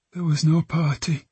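put-on high girl's voice, weighed down; heavy, flat. A narrator's reading. Girl admits the truth to detectives, heavy. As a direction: say it heavily, without life. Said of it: "Her voice was heavy, flat".

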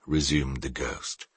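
usual speaking voice

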